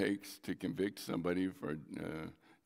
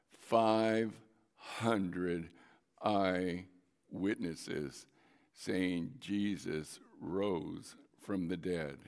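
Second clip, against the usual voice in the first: five hundred eyewitnesses, each word drawn out and slowed; slow it right down on five hundred eyewitnesses; slow emphatic count; 3 semitones lower, much slower than usual. Acted. Preacher driving the number home to his congregation, slow and weighty.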